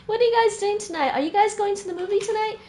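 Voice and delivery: high, fast Toronto-style chatter with "guys"; imitating a Toronto accent, brisk. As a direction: play up the Toronto "you guys". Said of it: "you gotta say you guys in Toronto".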